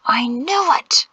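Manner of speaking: slow, high, intense hushed outburst; furious whisper. A narrator's reading. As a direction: force it out slowly, angry but hushed. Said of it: "Peto whispered furiously".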